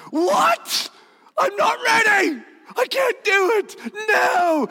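high, frantic mock panic; panicked. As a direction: shriek like you're about to be sick. Imagined panicking listener.

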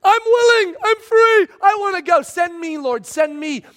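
put-on the keen kid with his hand up; eager volunteer. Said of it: "And Isaiah's like".

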